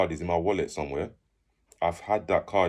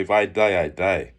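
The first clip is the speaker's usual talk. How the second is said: firm retold declaration, louder at the start; confident; 8 dB louder, slower than usual. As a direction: state it with confidence. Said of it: "I said it with confidence".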